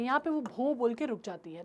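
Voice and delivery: ordinary delivery, nothing marked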